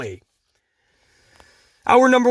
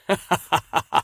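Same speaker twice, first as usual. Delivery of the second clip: chuckling at your own jab; amused laugh; 4 semitones lower than usual. Real, not acted. Reviewer after joking about Ricochet, amused chuckle. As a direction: let out a run of chuckles.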